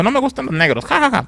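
high sneering mock voice with fake laugh. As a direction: ham up a snotty girl laughing cruelly. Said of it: "y le dijo".

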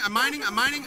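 ordinary delivery

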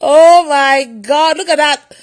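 loud, drawn-out amazement; loud, stretched exclamation; amazed exclamation. Real, not acted. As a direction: cry it out slowly in awe. Amazed at viewers' reported reactions.

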